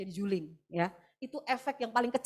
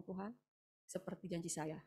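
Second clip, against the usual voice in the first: much softer, a near-private plea; hushed prayer; 3 semitones lower, 13 dB quieter than usual. Retold or imitated. Mother prays alone at the hospital washbasin, hushed. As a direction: murmur it as if alone at the sink.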